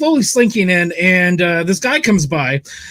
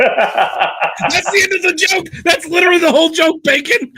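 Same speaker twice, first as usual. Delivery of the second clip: laughing; 7 semitones higher than usual. Real, not acted. Laughing at own failed joke.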